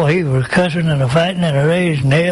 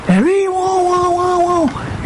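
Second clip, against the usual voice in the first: high wailing rocket whine; squealing rocket; 14 semitones higher, slower than usual. Retold or imitated. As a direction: wail it high like an incoming rocket. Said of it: "It's a squealing, make an awful racket".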